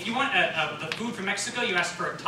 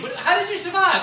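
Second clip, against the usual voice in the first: high, shocked question; mock amazement; 12 semitones higher, 6 dB louder than usual. A listener stunned by the building story, high and shocked. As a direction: gasp it out in disbelief.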